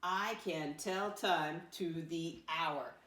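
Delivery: slow read-aloud for a class to join; read-along. Shown as a demonstration. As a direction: lead them through it slowly. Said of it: "Read it with me".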